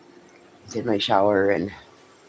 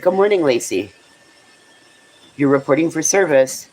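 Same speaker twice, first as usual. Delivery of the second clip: sweet greeting; 5 semitones higher than usual. Pretend. Voicing a greeting to pet.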